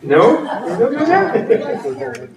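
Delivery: high, drawn-out incredulous question with a laugh; mock disbelief. Real, not acted. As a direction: act shocked they never heard it.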